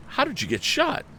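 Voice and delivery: high, urgent question; alarmed question. A narrator's reading. Tom asking the wounded hunter, urgent and high. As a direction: demand it in alarm. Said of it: "the young inventor repeated".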